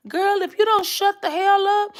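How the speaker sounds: high, sassy put-on voice; sassy put-on voice for the patient next door; annoyed neighbour. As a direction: snap at the noisy girl with attitude.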